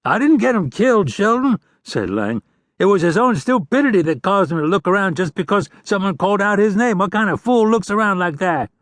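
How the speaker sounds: high, fast whining protest; defensive outlaw. A narrator's reading. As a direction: argue back in a panic. Lang defending himself.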